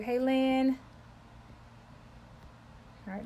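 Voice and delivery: ordinary talk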